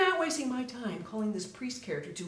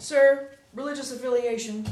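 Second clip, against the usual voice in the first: louder, insistent demand; impatient repeat; 4 semitones higher, 7 dB louder than usual. Acted. Officer repeats the ignored question to the jumper, louder and impatient. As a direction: press him for the answer, fed up.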